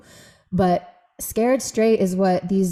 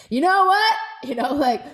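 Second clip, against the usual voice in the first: angry venting; 7 semitones higher than usual. Retold or imitated. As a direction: let the rage out at someone. Venting anger alone in practice.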